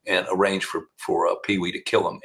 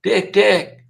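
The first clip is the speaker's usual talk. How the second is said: much higher, calling voice; high-pitched Pee Wee; 10 semitones higher, 4 dB louder than usual. Retold or imitated. Killer calling the prosecutor at lunch, high-pitched. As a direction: call across the courtroom in a high voice. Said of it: "in a high-pitched voice".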